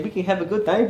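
put-on teasing copy of an Indian accent; imitated Indian accent. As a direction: mimic his friend's botched Indian accent. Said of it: "they started with the Indian".

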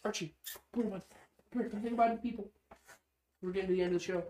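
dog-talk voice. Real, not acted. Calling the dog over.

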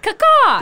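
imitating a squeaky bird call; high-pitched caw-caw. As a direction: mimic the high crow cry. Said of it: "you went kinda high-pitched".

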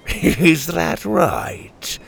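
very slow, chuckling taunt; amused chuckle. A narrator's reading. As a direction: laugh him off. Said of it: "The captain chuckled".

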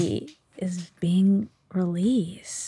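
whispered, slow and secret; hushed whisper. Real, not acted. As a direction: breathe it like a secret. Dramatic news about Adnan's release.

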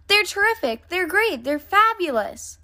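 put-on high-pitched, eager kid raving; gushing excitement. A narrator's reading. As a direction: gush with rising delight over a favorite toy. Brother gushes about the toys.

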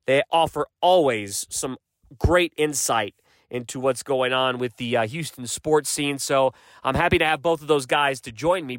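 usual speaking voice

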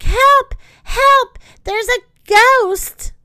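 high loud yells for help; frightened yell. A narrator's reading. Jetta running back from the ghost, yelling for help. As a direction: scream for help, terrified. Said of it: "Jetta yelled".

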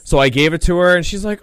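ordinary talk

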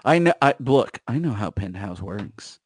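ordinary talk, nothing marked

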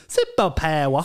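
exaggerated Australian imitation; mock Australian. Co-host mimics host, exaggerated mock Australian. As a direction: send up her Australian accent. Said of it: "when she does Australian accents".

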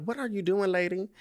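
high, incredulous question; puzzled call. Retold or imitated.

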